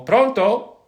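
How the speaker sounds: high, loud phone greeting; calling pronto into a phone. Shown as a demonstration. Demonstrating how Italians answer the phone, a loud high pronto. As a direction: call it out like picking up a ringing phone. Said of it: "That's when you answer a phone".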